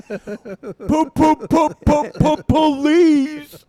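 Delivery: high, loud silly hooting; silly sound-making. Pretend. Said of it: "making those sounds".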